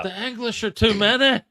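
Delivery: high, put-on character voice; mock film quote. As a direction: cry it out like a hammed-up movie line.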